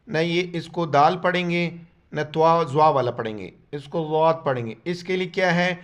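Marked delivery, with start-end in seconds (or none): none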